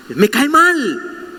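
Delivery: slow, high, sulky drawl on mal; drawn-out complaint. Retold or imitated. Believer telling God again, slowly and sulkily. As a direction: stretch it out like a sulking child.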